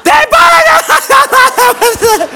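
very high, loud squeal through laughter; slow, squealed words breaking into laughter; laughing too hard to breathe. Retold or imitated. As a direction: imitate a girlfriend choking out words while laughing. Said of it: "but she can't breathe".

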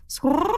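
high, rolled bird squawk; raven squawk. A narrator's reading. Raven warns of winter, squawking.

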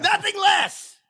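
high, loud mock heroic cry; mock Shakespearean shout to a laughing friend; hammy battle shout. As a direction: top off the war cry with a bellow. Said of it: "I don't know if Henry Five is a".

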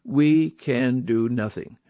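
slow, weighty, every word landed; a preacher spelling out a hard truth; slow and emphatic. Shown as a demonstration. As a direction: press each word home slowly. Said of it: "friends, let's face it".

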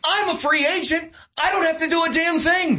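high-pitched defiant retort, acted out; LeBron James, defiant. Star player answering the commissioner, defiant. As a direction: imitate an indignant star pushing back. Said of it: "And, and LeBron says".

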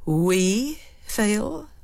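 "We" stressed, rising question. Shown as a demonstration. Lady Macbeth stressing "We" to her husband. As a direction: lean hard on "We". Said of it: "Suppose we change the emphasis".

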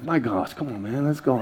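muttering under his breath; impatient mumble in line; muttered impatience. Retold or imitated. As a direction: grumble quietly to yourself. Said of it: "just being like".

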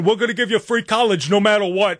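lightly voiced Bernie promise; a hint of a rally pledge; mock Bernie Sanders. Host mocking Bernie Sanders promising free college, light impression. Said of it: "Bernie Sanders going".

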